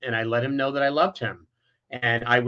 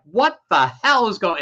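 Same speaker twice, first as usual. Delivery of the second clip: higher, louder, punched-out question; retold outraged shock; 6 semitones higher, 5 dB louder than usual. Family reacting to nephew's disclosure, retold outraged shock. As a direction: imitate relatives thrown into disbelief.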